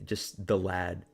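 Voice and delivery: ordinary talk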